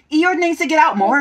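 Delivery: high, loud fussy rabbit. Acted. Reader voicing Rabbit's complaint, high and loud. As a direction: say it as bossy Rabbit.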